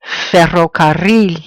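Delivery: ferrocarril, slowly, landing on RRIL; slow ferrocarril, weight on the end; final-syllable stress. Shown as a demonstration. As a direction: stretch the last syllable of ferrocarril. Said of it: "Stress falls on the end".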